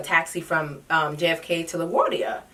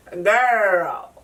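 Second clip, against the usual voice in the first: usual speaking voice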